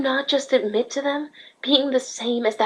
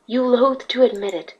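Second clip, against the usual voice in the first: ordinary delivery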